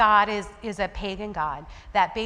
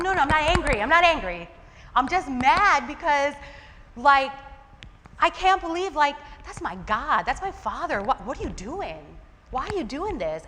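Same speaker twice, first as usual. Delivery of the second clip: higher, heated retold outburst; mad protest; 5 semitones higher, 4 dB louder than usual. Speaker replays her argument over reading scripture, mad and protesting. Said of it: "I was walking around mad yesterday".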